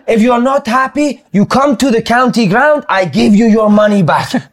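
high, slow Italian manager offer; Italian accent. Retold or imitated. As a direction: lecture the fans in broken English. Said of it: "He's like".

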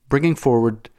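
ordinary delivery, nothing marked

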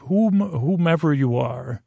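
ordinary talk, nothing marked

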